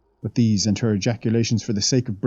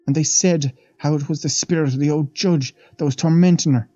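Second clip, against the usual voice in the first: a lightly voiced old maid, awed; old Irish housemaid; 7 semitones higher, 3 dB louder than usual. The maid leans in to the young men, lightly voiced and awed. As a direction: tell it like a wide-eyed servant gossiping.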